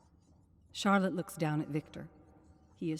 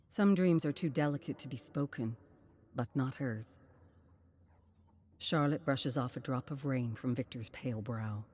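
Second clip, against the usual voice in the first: plain reading voice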